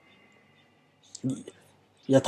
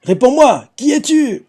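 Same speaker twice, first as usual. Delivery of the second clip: much higher, urgent mock call; Luffy demands an answer from the voice, higher; 9 semitones higher, slower than usual. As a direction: call out to an unseen voice, pitched up. Luffy calling out to the voice.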